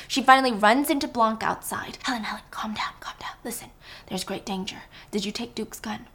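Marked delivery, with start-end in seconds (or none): 2.0-6.1 soft urgent whisper. Acted. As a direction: hush her in the dark. Blanc whispering in the dark.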